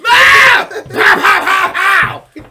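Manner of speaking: high, loud shouted pow sounds; punch noises. Retold or imitated. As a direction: shout the punches like a cartoon fight. Acting out punches.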